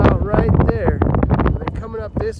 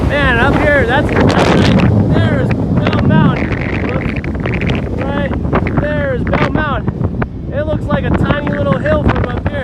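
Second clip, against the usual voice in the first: excited; 9 semitones higher, 6 dB louder than usual. Real, not acted. Summit awe, loud over wind.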